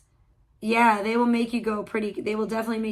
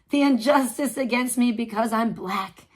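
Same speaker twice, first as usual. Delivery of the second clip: ordinary delivery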